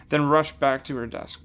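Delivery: ordinary delivery, nothing marked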